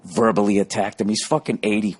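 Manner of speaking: normal talking voice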